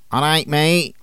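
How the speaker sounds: lad's Manc greeting; Manc accent. Shown as a demonstration. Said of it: "listen to their equivalents in Mank dialect".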